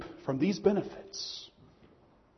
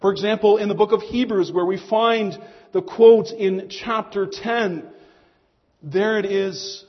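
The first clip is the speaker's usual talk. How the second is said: ordinary talk, nothing marked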